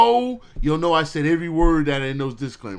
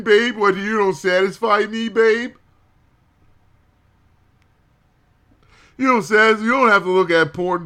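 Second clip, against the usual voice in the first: louder, fast whiny mock of an insecure lover; mock pleading partner; 3 semitones higher, 4 dB louder than usual. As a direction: play the needy partner calling everyone babe.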